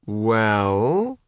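drawn-out, rising hesitation; hesitant. A narrator's reading. Faith starts an idea and stops, hesitant. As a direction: stretch the word upward, then stop. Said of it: "then stopped".

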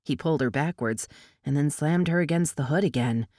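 usual speaking voice

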